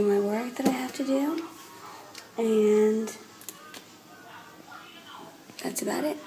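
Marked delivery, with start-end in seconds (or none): 5.6-6.2 whisper. Real, not acted. Trailing off.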